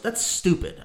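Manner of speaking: ordinary talk, nothing marked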